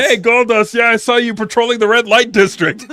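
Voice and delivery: mock caller. Imitating a prank caller.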